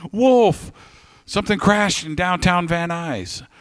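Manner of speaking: high-pitched excited report; retold urgent call. Driver phoning a friend about a crash, high urgent report.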